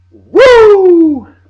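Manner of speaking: piercing high wrestler's woo; Ric Flair woo. Retold or imitated. Shouting out to wrestling fans with a big woo. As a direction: let out a huge Flair-style woo. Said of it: "Ric Flair, baby".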